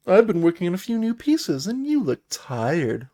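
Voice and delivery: high, showy bard voice, slowing at the end; playful bard. Acted. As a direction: chat up a hero as a theatrical performer. Voicing the bard NPC's line.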